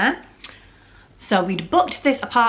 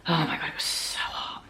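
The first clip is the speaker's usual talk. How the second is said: whisper; 8 dB quieter than usual. Acted. Recalling heat, whispered.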